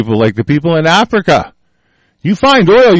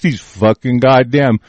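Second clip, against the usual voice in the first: normal talking voice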